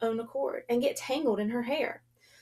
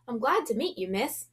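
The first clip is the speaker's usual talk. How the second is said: plain reading voice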